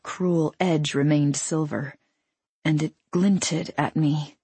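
plain reading voice

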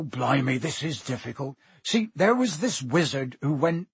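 rustic giant, uneasy and halting; reluctant, exclaiming character voice; Hagrid. Acted. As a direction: struggle to reach the dreaded name.

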